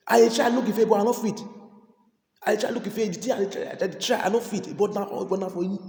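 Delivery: urgent call to repent; fervent preaching. Real, not acted. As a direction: press the congregation hard. Preaching at full force.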